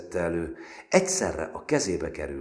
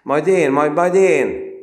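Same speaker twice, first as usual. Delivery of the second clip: high, loud, pushing eager call; eager shout; 12 semitones higher, 16 dB louder, slower than usual. Acted. Péter pushing forward to handle the recruit, eager shout. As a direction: elbow your way in, eager to take over.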